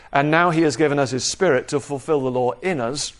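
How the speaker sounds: ordinary talk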